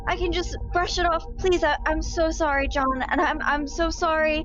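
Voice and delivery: flustered apology. Acted. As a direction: play it up, spilling the wine on purpose. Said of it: "I'm gonna go".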